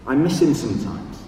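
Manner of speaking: measured poem recital with long pauses; poetry reading. Acted. Reads own poem aloud.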